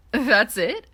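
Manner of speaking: startled question. Acted. Keith startled by the ear rub.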